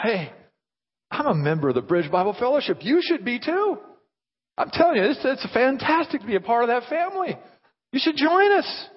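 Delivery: high-pitched, gushing invitation; so glad. Acted. Glad member inviting others to join the church, enthusiastic. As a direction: sell it with delighted enthusiasm. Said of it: "What if we were glad? So glad".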